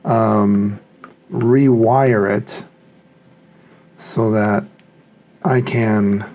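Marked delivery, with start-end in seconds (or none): none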